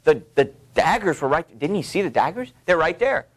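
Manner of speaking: a hint of an annoyed wife; exasperated Lady Macbeth. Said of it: "And so she's".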